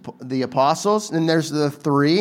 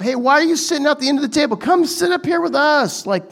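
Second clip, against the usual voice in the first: higher, fast eager host inviting you up; host calling you up; 8 semitones higher than usual. Retold or imitated. Host calls a guest to the head table, eager and higher. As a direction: call them up to the table like a friendly host. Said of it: "better to be called up".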